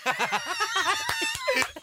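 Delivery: long, high-pitched laugh; real laughter. Real, not acted. Reacting to friend's tie joke, cracking up.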